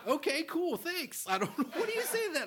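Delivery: high-pitched giggly voice, cracking up; laughing so hard the voice goes up high; laughing high retelling. Retold or imitated. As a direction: squeak through the laughter. Retold reply, still laughing.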